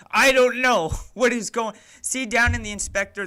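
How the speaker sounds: frustrated outburst. Real, not acted. Frustrated streamer, project broken.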